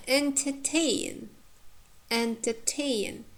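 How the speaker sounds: slow "entertain" with stress on "tain"; teacher's measured "entertain", leaning on the final syllable; stress on last syllable. Shown as a demonstration. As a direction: say "entertain" slowly, weak middle, long strong "tain". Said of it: "last syllable is stressed".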